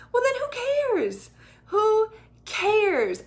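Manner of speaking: high, slow shrug-off; dismissive. Retold or imitated. Sister worries about gossip, she waves it off loudly. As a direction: brush it off with a high drawn-out who cares. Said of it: "And I said".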